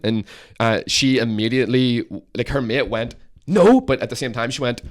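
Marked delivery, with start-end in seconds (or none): 3.5-3.8 high, loud mock yell; emphatic denial. Mate answers whether they'd been drinking, loud mock denial. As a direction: imitate a friend's instant loud denial.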